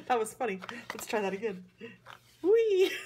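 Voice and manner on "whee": high, loud squeal of delight with a laugh; laughing whee at a little moving card; gleeful whee. Real, not acted.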